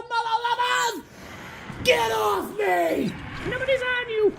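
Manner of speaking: very high, stretched-out shouts; ecstatic yelling. Real, not acted. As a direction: yell like you can't believe your luck. Wild outburst over pulled card.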